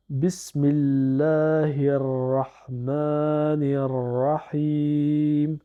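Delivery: very slow Arabic recitation. Shown as a demonstration. Teacher reading the whole verse to learners, very slow. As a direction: stretch every long vowel and shadda. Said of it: "Donc, ça nous donne".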